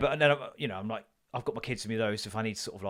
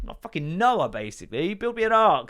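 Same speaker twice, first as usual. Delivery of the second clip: high, loud mock tirade at the bird; mock rant; 12 semitones higher, 8 dB louder than usual. Dad ranting at the rescued bird, loud and high.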